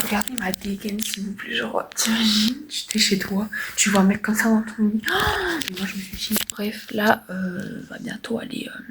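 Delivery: soft hushed whisper. Real, not acted. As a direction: keep it hushed, nurses nearby. Whispering so nurses don't hear.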